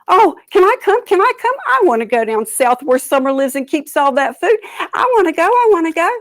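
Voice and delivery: high, loud, eager pleading; eager begging. A narrator's reading. Turtle begging birds to take him south, high and eager. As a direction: beg like a greedy child.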